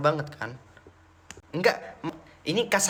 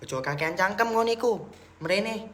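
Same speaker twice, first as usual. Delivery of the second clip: mock Surabaya taunt; 7 semitones higher than usual. Repeats harsh Javanese taunt.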